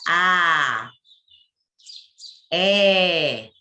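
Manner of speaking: A and É held long and loud, mouth wide open; open vowels. Shown as a demonstration. Teacher demonstrating open timbre to class, wide-open vowels. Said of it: "pronunciadas com a boca bem aberta".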